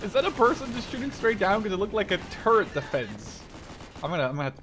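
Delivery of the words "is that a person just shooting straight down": much higher, louder shocked exclamation; startled outburst. Real, not acted. Player reacting to someone shooting straight down, shocked and loud. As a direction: blurt it out in disbelief. Sees strange shooting from above.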